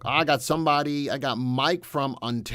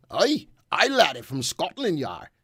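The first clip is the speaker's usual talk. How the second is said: a jokey, higher, louder Scottish brogue; playful put-on Scottish accent, unsure if it's Irish; 3 semitones higher, 5 dB louder than usual. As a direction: greet him like a hammy Scot with a Scottish lilt. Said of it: "I don't know if that was Irish or Scottish".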